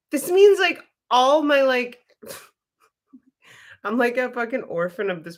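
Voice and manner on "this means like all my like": retold excitement. Retells her excited realization.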